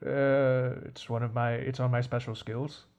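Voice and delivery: low, very soft and halting butler; resigned butler, quiet and low, with long pauses; world-weary old servant. Acted. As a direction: speak low and softly, as if giving up. Butler answers the villains.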